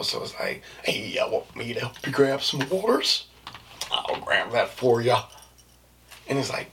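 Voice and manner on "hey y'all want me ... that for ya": put-on helpful coworker, getting louder; immature work voice. Shown as a demonstration. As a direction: play up the eager helper, stretching the offer out. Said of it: "immature though".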